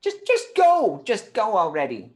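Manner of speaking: high-pitched, fed-up plea to leave; exasperated dismissal. Acted. Acted scene, dismissing the curse-caster.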